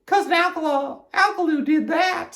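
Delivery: high-pitched character voice; Amelia Bedelia. A narrator's reading. Amelia Bedelia to Alkaloo, high character voice. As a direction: say it up high at normal volume. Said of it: "said Amelia Bedelia".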